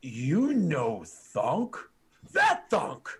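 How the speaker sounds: orc realizing. Acted. Orc realizes who stands before him, slow then loud. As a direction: drag it out, then blurt the recognition. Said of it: "And he's like".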